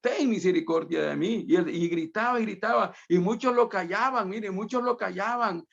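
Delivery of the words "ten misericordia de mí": high-pitched mock cry for mercy; high pleading call, only half shouted; imitated crying out. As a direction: call out like a blind man begging, without full volume.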